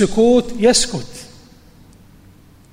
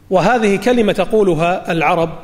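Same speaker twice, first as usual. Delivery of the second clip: ordinary talk, nothing marked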